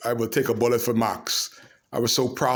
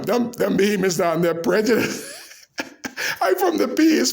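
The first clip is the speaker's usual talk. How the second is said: laughing; 7 semitones higher than usual. Real, not acted. Laughing while telling the punchline.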